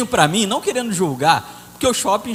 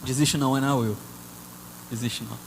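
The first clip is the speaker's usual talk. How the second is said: soft plea; 11 semitones lower, 8 dB quieter than usual. Acted. Pleading softly not to give up.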